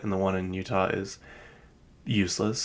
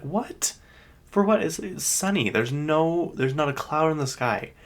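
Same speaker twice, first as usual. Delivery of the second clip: high-pitched, incredulous protest; retold disbelief; 8 semitones higher than usual. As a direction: challenge a nonsensical delay, pitch shooting up. Retold disbelief at flight delay.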